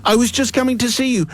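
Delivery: high-pitched helpful lady. Acted. Lady explains to mother.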